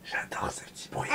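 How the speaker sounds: playful voiceless whisper, close to the microphone. Real, not acted. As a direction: whisper it gently to your little one. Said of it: "que je chuchote".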